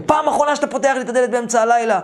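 fast, high-pitched telling-off; retold scolding. Roommate warns him about the door, in a fast high scolding. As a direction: act out a fed-up last warning.

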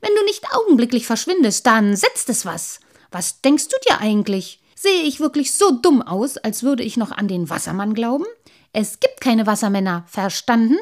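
loud, irate rant, high outburst at start; fed-up, emphatic dressing-down; angry threat. A narrator's reading. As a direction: threaten and scold forcefully. Man threatens and scolds sprite.

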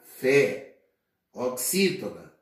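ordinary talk, nothing marked

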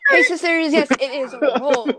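high, laughing beauty vlogger greeting; giggly sing-song intro; YouTuber impression. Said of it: "That's how Roll talks".

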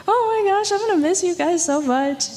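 reliving the moment she broke down; tearful goodbye. Retold or imitated. Telling the church how she cried saying goodbye, high and choked up.

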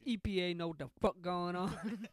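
loud hype shout; hyped shout. Real, not acted. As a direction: shout it like a gang chant. Hype chant after joke.

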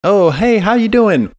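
high-pitched, over-friendly greeting; hammed-up friendly corporate visitor; chirpy company rep. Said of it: "someone from, uh, let's say, Microsoft".

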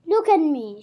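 loud, stern command to look; commanding old lady. Acted. Old woman ordering young Pip, stern and loud. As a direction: order the boy to look at her.

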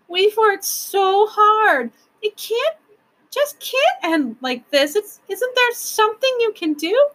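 put-on high, slow wail of a child; tearful pleading. A narrator's reading. Lindy begging the proc to help, tearfully pleading. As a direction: plead high and drawn out, near tears.

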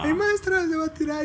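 put-on very high squeaky girl's voice; little girl tattling. Little girl running to tell the teacher, very high squeal. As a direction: pitch way up like a panicked little girl calling teacher.

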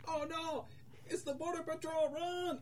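high-pitched warning cry; hammed-up fleeing townsperson; mock panic. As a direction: yelp a pretend warning to everyone. Next townsperson flees the monster.

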